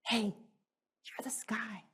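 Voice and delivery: slow, soft whisper; birdie whisper. Retold or imitated. Mother voicing a little birdie whispering to the sky. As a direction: whisper it slowly like a tiny bird. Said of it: "had to whisper to the sky".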